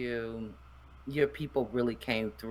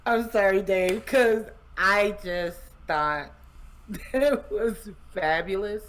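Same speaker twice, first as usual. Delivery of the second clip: laughing apology; 5 semitones higher, 4 dB louder than usual. Real, not acted. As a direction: apologize through real laughter. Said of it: "And, and you're laughing".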